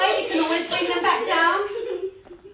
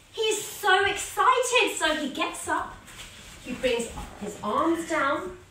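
ordinary talk, nothing marked

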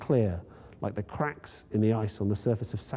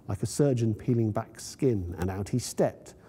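ordinary delivery, nothing marked

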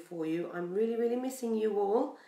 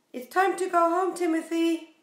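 dad calling; 8 semitones higher, 6 dB louder than usual. Acted. Dad calls Timothy home.

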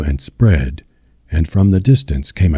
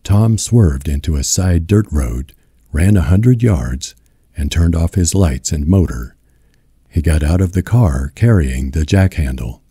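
plain reading voice

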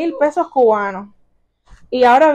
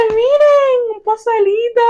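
high-pitched, sing-out excitement; delighted surprise; 9 semitones higher than usual. Real, not acted. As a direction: show off the find in a high, happy voice. Delighted find while unboxing. (con voz aguda, emocionada)

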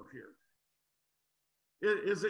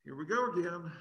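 ordinary talk, nothing marked

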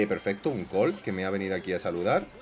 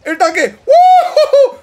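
excited shouting; 14 dB louder, slower than usual. Real, not acted. Finally gets the tank.

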